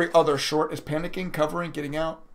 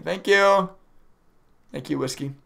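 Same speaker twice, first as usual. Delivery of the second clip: usual speaking voice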